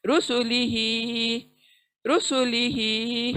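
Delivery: रुसुलिही with only ही stretched; slow tajweed reading of रुसुलिही; drawn-out recitation. Shown as a demonstration. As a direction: hold ही in रुसुलिही, say the rest quickly. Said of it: "ही को ही खींचना है बस".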